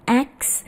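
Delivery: X in place of ask, s and k swapped; mispronounced ask. Shown as a demonstration. Teacher warns students off the slip, mispronounced. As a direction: say it wrongly as X, aks. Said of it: "Jadi bukan X".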